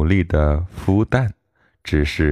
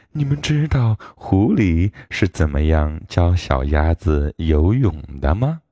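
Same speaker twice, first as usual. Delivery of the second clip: plain reading voice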